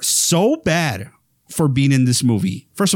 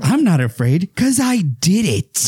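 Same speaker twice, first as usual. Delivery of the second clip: smug killer reveal; 6 semitones higher than usual. Retold or imitated. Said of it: "you're like".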